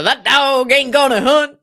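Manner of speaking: slow, loud Southern drawl with a laugh; laughing through a hammed-up Southern accent. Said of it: "That's my best Southern accent".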